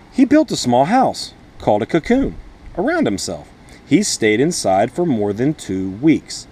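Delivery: lively picture-book storytelling voice; reading to children. Acted. Teacher reading The Very Hungry Caterpillar to her class, storytelling voice. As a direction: read it like story time for little kids.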